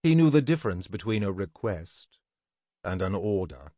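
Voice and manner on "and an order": ordinary delivery, nothing marked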